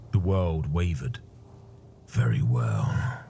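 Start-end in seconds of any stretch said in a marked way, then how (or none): 2.1-3.2 low, slow, breathy resignation; weary warrior. Acted. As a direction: sigh it out, wounded and low.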